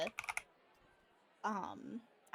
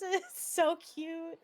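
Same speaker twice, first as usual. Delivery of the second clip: gushing laugh; 7 semitones higher, 5 dB louder, much slower than usual. Real, not acted. Gushing over favourite chapters.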